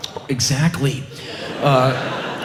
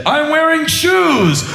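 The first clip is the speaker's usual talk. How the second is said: high, slow rock-star shout; mock arena announcement hollered out; 13 semitones higher, 4 dB louder, slower than usual. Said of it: "if he said".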